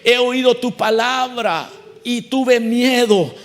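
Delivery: prayer that swells to a loud cry; fervent prayer rising to a shout; pleading prayer. Retold or imitated.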